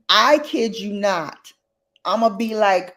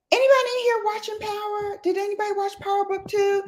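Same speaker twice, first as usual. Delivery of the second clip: very high, fast singsong call; singsong question; 13 semitones higher, much faster than usual. Real, not acted. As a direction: chirp the question quickly to the audience. Calls out to the live audience.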